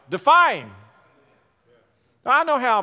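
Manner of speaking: loud, high defiant shout; rousing preacher's shout; shouted exhortation. Real, not acted. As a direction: hurl it like a battle cry.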